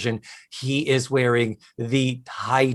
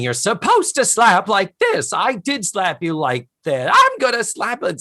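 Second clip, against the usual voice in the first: higher, louder hammed-up squabble; mock quarrel; 8 semitones higher, 3 dB louder than usual. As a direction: act out the brawl half in jest. Retold slapping quarrel.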